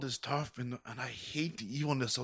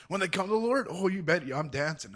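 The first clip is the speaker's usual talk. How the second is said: excited; 7 semitones higher, 6 dB louder than usual. Real, not acted. Joy over new believers.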